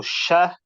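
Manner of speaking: open, hissing start on "sha"; spreading sh sound. Shown as a demonstration. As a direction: spread the air through the sh of "sha". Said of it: "have that open, openness".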